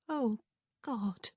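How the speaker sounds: high, dismayed moan. A narrator's reading. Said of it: "Prudence moaned".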